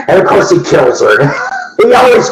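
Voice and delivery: laughing while telling it; words breaking into real laughter. Real, not acted. Laughing through [name] story.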